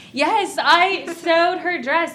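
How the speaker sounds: slow, lightly drawn-out Southern dad voice; a hint of a sweet Southern gentleman, slowed right down; sweet Southern man. Retelling her friend's dad's joke, a light slow Southern man voice. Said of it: "This sweet Southern man is like".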